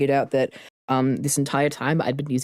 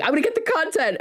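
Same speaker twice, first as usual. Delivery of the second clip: high voiced quote; 14 semitones higher than usual. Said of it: "to be like".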